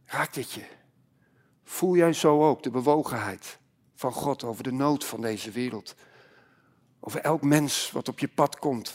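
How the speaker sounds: low, soft pastoral appeal; hushed preaching. Acted. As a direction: draw the congregation in quietly and solemnly.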